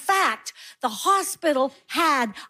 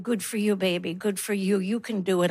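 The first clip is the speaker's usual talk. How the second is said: put-on soft, low coaxing to a tiny baby; hushed loving encouragement; tender coo; 5 semitones lower, 4 dB quieter than usual. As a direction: gently encourage the newborn. Cooing to premature baby.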